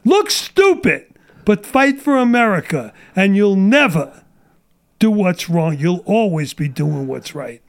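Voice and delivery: ordinary delivery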